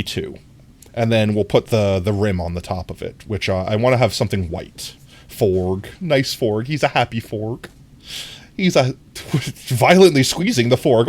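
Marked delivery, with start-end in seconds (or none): none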